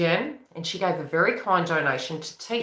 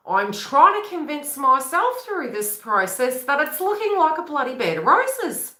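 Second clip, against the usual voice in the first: raised pitch, trying to convince herself; inner feminine voice; 8 semitones higher, 3 dB louder than usual. Retold or imitated. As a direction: lift the voice in mock self-persuasion. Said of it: "Feminine's like".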